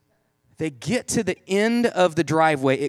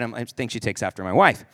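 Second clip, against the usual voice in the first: usual speaking voice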